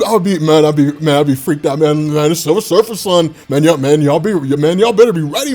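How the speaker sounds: ordinary talk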